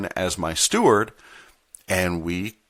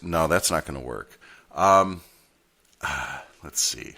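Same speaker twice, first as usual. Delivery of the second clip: ordinary talk, nothing marked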